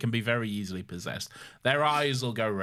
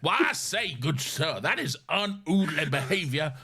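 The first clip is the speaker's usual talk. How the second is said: courtly Southern gentleman; 4 semitones higher than usual. Pretend. Showing the co-host the idea, affronted Southern gentleman. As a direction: protest grandly as a mock Southern gentleman. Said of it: "imagining just a courtly southern gentleman".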